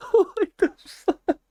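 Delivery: high-pitched laughing shock; laughing disbelief. Real, not acted. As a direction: crack up in shock.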